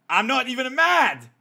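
mock fuming. Streamer playing an angry boyfriend, loud mock fury. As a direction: yell it like you're totally not mad. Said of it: "He's fuming".

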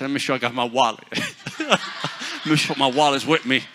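loud joking worry for his wallet; laughing mock alarm. Preacher joking to the congregation about his wallet, loud and laughing. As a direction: clutch your wallet, laughing.

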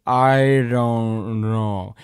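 very slow, dragged-out daze; dazed slow reply. Retold or imitated. Dazed teen answering his aunt, very slowly. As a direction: stretch each word like you're out of it.

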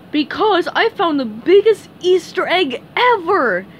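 high, loud cartoon boast; boastful Patrick. A narrator's reading. Patrick tells SpongeBob of his huge find, boastful. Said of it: "said Patrick".

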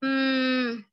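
ordinary talk, nothing marked

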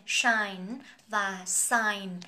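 "shine" with sh, then "sign" with light s, slow; sh versus s. Shown as a demonstration. Teacher drilling the two words side by side. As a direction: slowly contrast "shine" and "sign" by their first sound. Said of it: "chỉ khác nhau ở cái âm đầu thôi".

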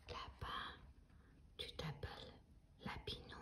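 soft secret whisper in the ear. Acted. Grown-up whispering the rabbit's name to the child, whisper. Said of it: "chuchota le nom du lapin ... si bas que personne ne l'entendit".

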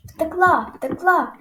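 put-on delighted boy shouting; joyful cry. A narrator's reading. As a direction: jump for joy as you call it out. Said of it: "радо підстрибнув я".

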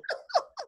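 short squealing laugh; high burst of laughter. Real, not acted. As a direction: laugh high after the joke. Laughs after her own joke.

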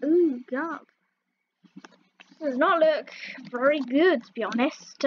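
normal talking voice

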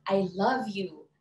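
slow, "love" stressed with a fall. Shown as a demonstration. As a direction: lean into "love".

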